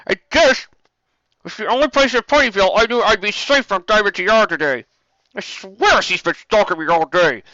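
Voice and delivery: high, loud tomboy filly; brash young filly. A narrator's reading. Scootaloo voice.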